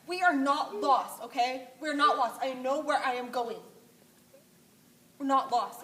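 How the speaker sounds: high, loud insistent coach; imitating Plummer. Coach Plummer telling lost runners they are not lost, high and loud. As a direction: insist loudly that nobody is lost. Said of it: "I am not good at imitations".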